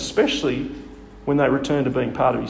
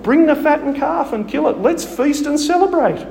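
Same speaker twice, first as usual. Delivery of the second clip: much higher, louder joyful command; overjoyed father calling for the feast; excited father; 11 semitones higher, 4 dB louder than usual. Retold or imitated. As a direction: throw open the feast. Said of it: "He is so excited to have him home".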